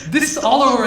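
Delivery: ordinary talk, nothing marked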